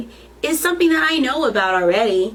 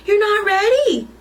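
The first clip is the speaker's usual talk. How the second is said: put-on child voice; 10 semitones higher, 3 dB louder than usual. A narrator's reading. Child correcting grandma.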